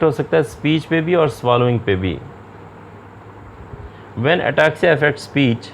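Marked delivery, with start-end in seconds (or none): 4.2-5.6 slow, deliberate dictation pace; slow slide reading. Acted. Lecturer reading slide text to students, slow and measured. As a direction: read each phrase out slowly for the class.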